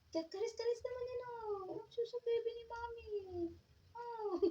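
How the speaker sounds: high sing-song pet talk. Real, not acted. Cooing at her cat Bella.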